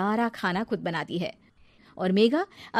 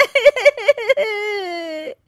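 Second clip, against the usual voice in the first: fake sobbing; 13 semitones higher, 8 dB louder than usual. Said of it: "रोने का नाटक करने लगती है".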